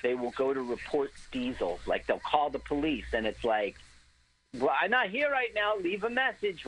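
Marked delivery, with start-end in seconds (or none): 4.6-6.7 high, hammy Irish cop voice; Irish cop answering machine; over the top Irish accent. Retold or imitated. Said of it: "with this stereotypical Irish voice".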